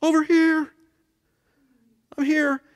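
hammed-up call of "over here". Shown as a demonstration. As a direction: wave them over from across a room.

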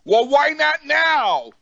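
high, loud, slow exasperation; exasperated high. Retold or imitated. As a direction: demand it in disbelief. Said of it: "I went".

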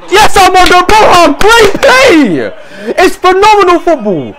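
high, loud celebration; real thrilled yelling; elated shouting. Real, not acted. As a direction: cheer a brilliant move. Celebrating a great attack.

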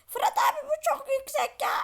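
high, loud whining sidekick voice; whiny robot voice. Pretend. Robot whines to Fırat abi, high-pitched and loud.

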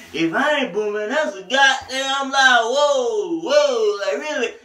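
high, loud, slow with hard T's and P's. Retold or imitated. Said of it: "emphasizing his T's and P's".

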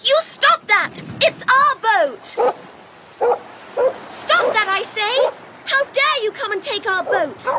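high-pitched angry yell; girl yelling high at the men taking her boat; yelling at the top of her voice. Acted. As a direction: shout it high to scare the men off. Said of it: "She yelled at the top of her voice".

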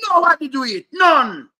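full-voiced angry yell, high and loud; outraged shout. Real, not acted. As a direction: shout it down like a final word. Rant peaks, shout heard.

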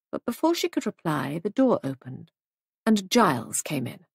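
usual speaking voice

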